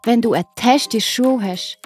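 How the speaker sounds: plain reading voice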